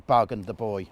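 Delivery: ordinary delivery, nothing marked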